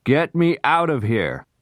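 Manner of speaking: high, slow, desperate plea to be let out; trapped man yelling in fear; high terrified shout from below; panicked cry for help. Acted. Noah trapped in well, panicking.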